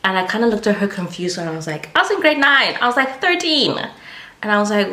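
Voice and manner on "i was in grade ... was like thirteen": high-pitched, incredulous retelling of a protest; exasperated squeal of disbelief, retold. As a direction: protest it high, falling off at the end. Protesting to confused-looking at teacher.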